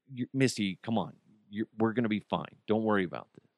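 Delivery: hushed, casual reassurance, retold; reassuring mom. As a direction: gently brush off a frightened child's worry. Mom reassuring frightened daughter in retelling.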